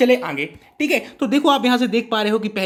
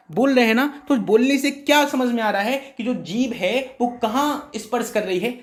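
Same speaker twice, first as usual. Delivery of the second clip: ordinary talk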